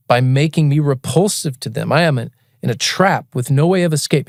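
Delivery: slow, grave Bible reading; solemn scripture reading. Acted. Reading Psalm eighty-eight to a drunk stranger, slow and solemn. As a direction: read the psalm slowly and heavily.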